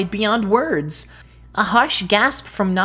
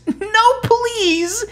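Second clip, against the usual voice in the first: much higher, louder desperate plea; begging; 5 dB louder than usual. Acted. Boy begs the dying tree, tearful. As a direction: beg through tears. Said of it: "he begged".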